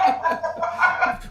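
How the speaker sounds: squealing unvoiced laugh; high breathless laugh. Real, not acted. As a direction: burst into helpless laughter. Laughing at friend spewing food.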